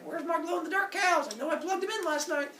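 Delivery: high-pitched, distressed god; high, comic dismay; upset Apollo. Acted. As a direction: fret it way up high. Apollo finds his cattle gone.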